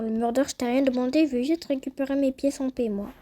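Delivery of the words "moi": usual speaking voice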